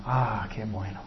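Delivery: a hushed "qué bueno" of relief; relieved sigh. Acted. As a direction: let it out like the danger has passed. Woman relieved after accusers leave.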